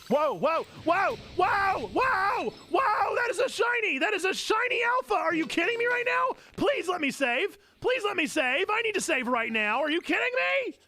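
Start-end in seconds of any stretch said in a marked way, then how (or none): 0.1-6.4 rising, higher and higher whoas into a shout; can't believe your luck; excited shouting. Real, not acted. Gamer spotting a rare shiny alpha, excited shouting. 6.6-9.8 higher, urgent begging; pleading. Real, not acted. Gamer begging to save before losing the shiny, pleading.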